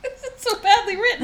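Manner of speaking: laughing. Real, not acted. Laughing at the letter.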